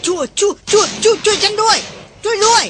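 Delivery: high, gasping cries for help tumbling out; stammering in panic. Acted. Panicked woman clinging to her rescuer, stammering for help. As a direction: beg for help in a breathless panic. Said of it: "หล่อนละล่ำละลักอยู่เช่นนั้น".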